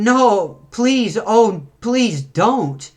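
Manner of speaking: high, urgent mock plea; hammed-up cry for mercy; pleading for help. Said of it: "they're calling for help".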